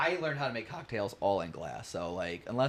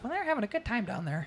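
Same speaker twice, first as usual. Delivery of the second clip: high-pitched mock parent voice; imitating a parent in falsetto; oblivious parent. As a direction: put on a high, cheery parent voice. Imitates easygoing parents in a story.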